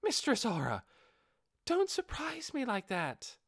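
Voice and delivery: put-on high-pitched girl, slowed and scolding; a flustered girl chiding a sneaky friend; startled girl.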